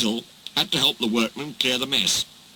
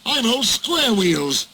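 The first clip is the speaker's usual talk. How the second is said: fuming; 8 semitones higher, slower than usual. Acted. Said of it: "fumed Henry".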